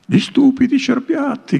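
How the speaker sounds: a high jeering yell; high, jeering shout from a rowdy companion; mocking shout. A narrator's reading. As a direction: shout it out to mock the deer. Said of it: "gridò uno".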